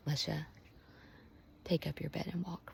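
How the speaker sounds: soft, hushed, near whisper; Jesus calling her name. Acted. Jesus to Lesha by name, hushed and soft. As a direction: speak it tenderly, almost under the breath.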